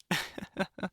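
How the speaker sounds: a quick airy chuckle at his own joke; breathy laugh. Real, not acted. Guardian laughing to Avery at his own joke, an airy chuckle. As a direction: laugh softly under the breath. Joke about hunting vampires.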